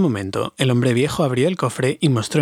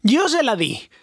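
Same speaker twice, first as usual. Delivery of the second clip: much higher, frightened hobbit squeal; a small hobbit's panicked high cry; terrified shriek; 10 semitones higher, slower than usual. A narrator's reading. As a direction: blurt it out in sudden terror. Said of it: "chilló Bilbo".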